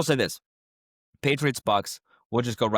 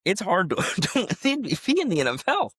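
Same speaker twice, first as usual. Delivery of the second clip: much higher, laughing and gushing; giddy excitement; 9 semitones higher than usual. Real, not acted. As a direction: gush like an overjoyed fan. Overjoyed about Drake Maye.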